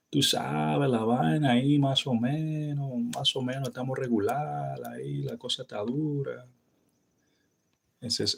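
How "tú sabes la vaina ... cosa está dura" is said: easygoing Dominican shrug in the voice; Dominican casual. Shown as a demonstration. Telling a friend things are so-so, laid-back Dominican. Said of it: "We just say".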